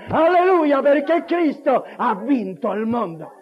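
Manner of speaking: loud, high proclamation building to a peak; fervent preaching. Real, not acted. Preacher proclaiming God as his victory to the congregation, fervent. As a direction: preach it out in rising jubilation.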